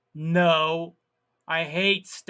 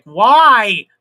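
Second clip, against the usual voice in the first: exasperated yell; 4 semitones higher, 12 dB louder, much slower than usual. Retold or imitated. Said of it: "and I'm like".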